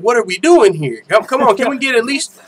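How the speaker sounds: acted-out baffled protest; exasperated disbelief. Acting out own exasperated reaction.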